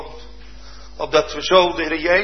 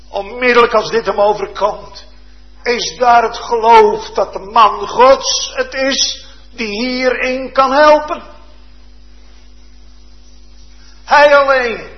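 louder, higher preacher's build to a climax; preaching at full force; 10 semitones higher, 8 dB louder than usual. Acted. Preacher proclaiming to the congregation, loud and higher. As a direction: drive the point home from the pulpit.

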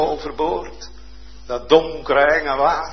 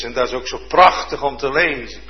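normal talking voice

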